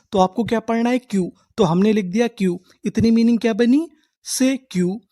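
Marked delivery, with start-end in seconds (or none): none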